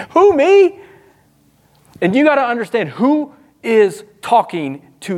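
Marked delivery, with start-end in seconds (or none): 0.1-0.8 high, louder mock disbelief; pitched-up disbelieving who me; incredulous who me. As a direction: squeak it out in disbelief. Mock surprised reaction, higher.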